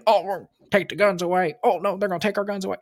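high, put-on mocking voices, fast at the end; mock whiny voices. Mocking politicians on both sides of the gun debate, high put-on voices.

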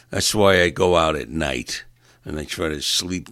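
usual speaking voice

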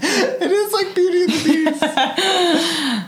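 high, slow sing-song through laughter; laughing. Real, not acted.

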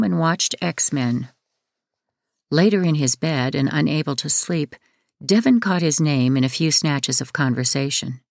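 ordinary delivery

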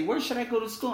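high, light question of a student. Retold or imitated. A student asking him about schools, high and light. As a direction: pitch way up like a young student asking.

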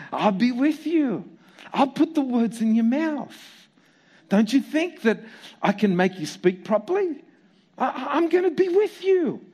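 God amused and reassuring; a hint of God's gentle laugh. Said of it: "God's response to his third excuse".

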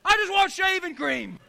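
exasperated. Acted. Exasperated punchline reply.

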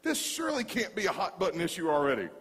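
can't believe what you're hearing; incredulous. Retold or imitated. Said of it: "And I'm like".

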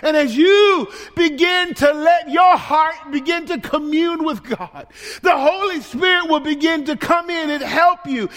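loud, high preacher's exhortation; shouting sermon climax; preaching. Acted. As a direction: press it home to the congregation. Preaching at full force.

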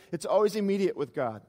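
ordinary talk, nothing marked

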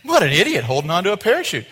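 loud, high, quick jeer; making fun. Acted. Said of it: "people start making fun of you".

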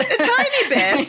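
high, amused echo; laughing echo. Real, not acted. Host echoes guest's small admission, laughing and teasing. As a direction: tease it back through a laugh.

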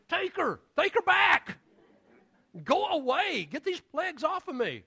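a king begging to be rid of her; frantic Pharaoh crying out. Retold or imitated.

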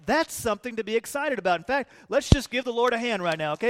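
ordinary talk, nothing marked

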